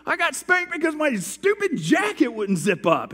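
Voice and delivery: put-on high, loud tearful kid complaint; boy complaining to mom, high and loud; whining child. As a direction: wail it like a wronged five-year-old. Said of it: "I said".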